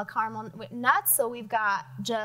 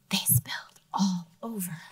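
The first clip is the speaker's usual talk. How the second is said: whisper; 3 semitones lower than usual. Acted. Child whispering to friends about the spilled sprinkles. Character's dismayed line in story.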